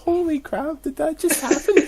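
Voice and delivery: high-pitched retold amazement; starstruck disbelief. Retelling starstruck reaction.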